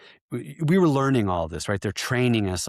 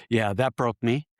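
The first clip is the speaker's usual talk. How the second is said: usual speaking voice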